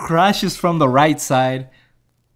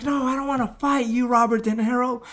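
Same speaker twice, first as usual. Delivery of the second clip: much higher mock refusal; imitating Rocky saying no, pitched up; refusing boxer; 10 semitones higher than usual. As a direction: turn him down in a put-on voice.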